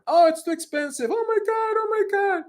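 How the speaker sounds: high, exaggerated whine; mock whiny complainer. Mocking those who said the coaching was too expensive, high mock whine. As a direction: mimic a complainer crying about the price.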